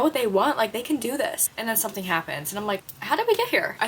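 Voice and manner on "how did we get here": high, incredulous question; pitched-up bewildered "How did we get here?"; exasperated disbelief. Retold or imitated. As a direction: ask in baffled disbelief. Shocked at the plot.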